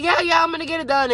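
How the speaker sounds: high, fast, careless yeah-yeah; breezy brush-off. Retold or imitated. Friend brushing off a reminder to finish chores, fast and breezy. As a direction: wave it off quickly like you'll never do it. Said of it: "They be like".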